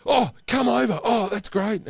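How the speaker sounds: high, loud cheery welcome; delighted welcome. Retold or imitated. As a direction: gush happily like a star greeting old friends. Star delighted fans arrived.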